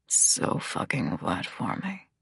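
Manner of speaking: very deep, slow, hushed growl; quiet, drawn-out male growl; low growl. A narrator's reading. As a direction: drop right down and growl it slowly. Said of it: "he growls into my lips".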